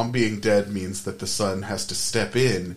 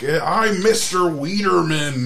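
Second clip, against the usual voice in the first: high, loud, drawn-out announcement; hammed-up slow holler; mock yell; 9 semitones higher, 6 dB louder, slower than usual. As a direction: imitate a man yelling his own name. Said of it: "yells it at his wife".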